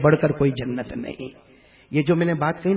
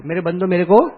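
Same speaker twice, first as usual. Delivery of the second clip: louder prompt; 6 dB louder than usual. Shown as a demonstration. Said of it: "بھول گئے آپ لوگ".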